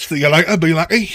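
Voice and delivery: loud, high Cockney farewell; a cheery Cockney goodbye; Cockney accent. Acted. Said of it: "doing my dreadful Cockney accent".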